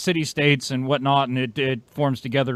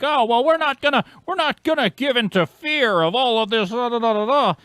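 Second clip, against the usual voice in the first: mocking whiny voice; 10 semitones higher, 3 dB louder than usual. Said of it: "they're like".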